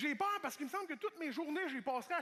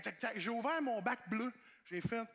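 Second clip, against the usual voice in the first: ordinary talk, nothing marked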